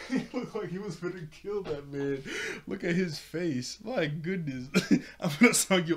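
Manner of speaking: talking through laughter; laughing. Real, not acted. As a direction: crack up describing him. Laughing at Oric's face.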